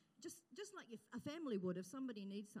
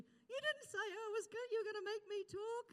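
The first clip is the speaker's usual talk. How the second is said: imitating Carol; 12 semitones higher, 5 dB louder than usual. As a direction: pitch it way up as the nervous woman protesting. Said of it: "Carol, who said to me".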